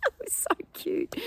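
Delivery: high, breathless whisper of delight; gushing. Real, not acted. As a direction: squeak out how cute it was. Gushing over baby in towel.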